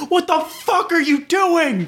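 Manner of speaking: high, slow mock yell of an angry driver. Imitating the driver yelling at a pedestrian, high mock yell. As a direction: squeal it out like a furious motorist. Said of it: "He's like".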